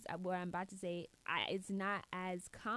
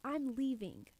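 exasperated; 4 semitones higher than usual. Acted. Acting out walking away.

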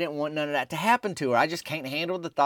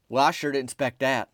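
ordinary delivery, nothing marked

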